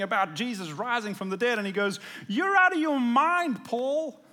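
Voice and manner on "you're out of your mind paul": high, loud, slower shout. A narrator's reading. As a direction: hurl it at him in disbelief. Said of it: "he shouted".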